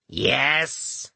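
put-on raspy old smoker's voice; scratchy voice. A narrator's reading. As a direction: scratch out the word like a chain smoker. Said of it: "in a scratchy voice that would put a pack-a-day smoker to shame".

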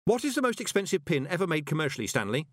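high, urgent question; quick. Acted. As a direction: jump in quickly to distract him. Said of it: "said Moist quickly".

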